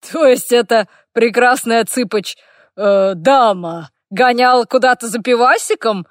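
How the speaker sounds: loud, mocking orc banter; guffawing orc. A narrator's reading. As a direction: tease boisterously as an amused orc. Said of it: "гоготнул орк". (гогочущий орк, громко)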